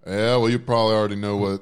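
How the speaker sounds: put-on a good-old-boy Southern accent on the phone. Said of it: "a Southern accent".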